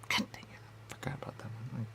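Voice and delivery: real whispered aside. Real, not acted. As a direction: whisper it to yourself in annoyance. Annoyed aside, forgot something.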